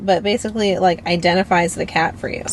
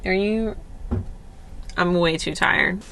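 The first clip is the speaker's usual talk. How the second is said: ordinary delivery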